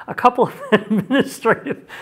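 trying to talk through real laughter; real laughing. Real, not acted. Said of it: "I'm sorry for laughing".

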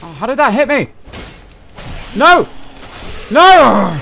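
loud, high furious yelling; raging gamer. Real, not acted. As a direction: scream at the game in outrage. Gamer gets hit, yells.

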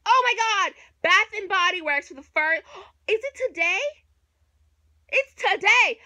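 loud, high gasping excitement; excited discovery. Real, not acted. Vlogger realizing the sale is today, loud excited gasp.

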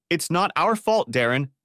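desperate cry. A narrator's reading. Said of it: "she cried out desperately".

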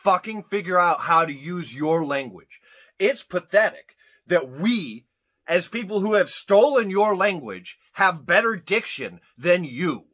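loud, emphatic scolding; comic angry rant. Real, not acted. Host scolds English speakers over diction, loud and indignant. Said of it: "I'm gonna have a little rant".

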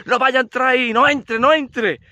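high, urgent yelling at the screen; husband shouting warnings at the television, pleading; man yells at his wedding video as if it were a film, high and pleading. Acted. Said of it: "pegándole gritos al televisor".

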